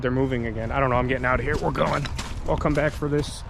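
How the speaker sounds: ordinary delivery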